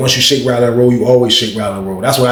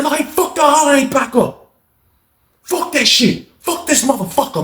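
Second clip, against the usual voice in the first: angry refusal; 13 semitones higher than usual. Retold or imitated. Lawrence refusing.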